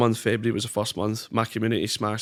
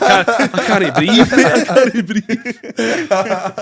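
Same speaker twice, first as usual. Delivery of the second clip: high, loud, breathless laughter through the words; gasping through the laughs like you can't stop; laughing helplessly; 11 semitones higher, 10 dB louder, much slower than usual. Retold or imitated. Telling the streamer how hard his laugh got you, laughing. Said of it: "I was like".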